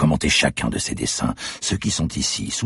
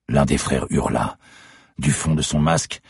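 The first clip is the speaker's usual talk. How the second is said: ordinary delivery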